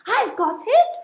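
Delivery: bright sudden idea; a eureka shout; exclaiming with a sudden idea; excited cry. Acted. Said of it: "she cried".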